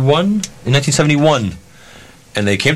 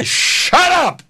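sharp high "Shut up!" barked out; retold yell. [name] yelling at the co-star to shut up. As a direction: snap at him to shut up. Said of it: "I'm ranting and raving at her".

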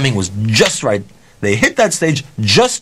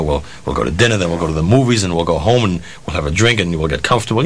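low-pitched mimicked date patter; coaxing promises, imitated; 7 semitones lower than usual. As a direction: list promises in a lowered, enticing murmur.

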